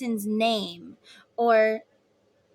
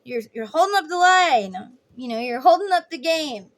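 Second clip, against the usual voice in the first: louder, exasperated ride operator; mock carny, loud and irritated; impatient carny; 3 semitones higher, 5 dB louder than usual. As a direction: hurry the kid along, fed up. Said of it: "the carny guy's like".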